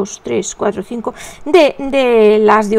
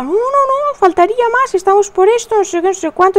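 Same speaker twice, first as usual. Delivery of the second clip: much higher, eager and polite; light imitation of an eager helper brushing off thanks; the obliging young man; 9 semitones higher, faster than usual. Neighbour boy insisting he's glad to carry the water, eager and much higher. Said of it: "Yo decía".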